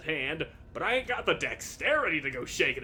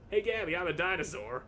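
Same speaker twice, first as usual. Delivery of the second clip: ordinary talk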